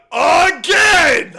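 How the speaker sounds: loud, slow hollered greeting; drawn-out shout. Real, not acted. Streamer hollers a welcome to a viewer, drawn-out shout. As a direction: stretch out the welcome loudly.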